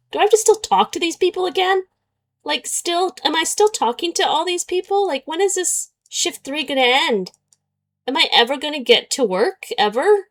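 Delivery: whiny complaint. Real, not acted. Complaining the game shift never ends, high whine. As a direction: moan about it, fed up. Whining about the endless shift.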